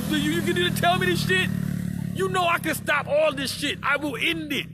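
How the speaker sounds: high, loud angry outburst; furious, high-pitched rant; angry shout. Real, not acted. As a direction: yell it out in real anger.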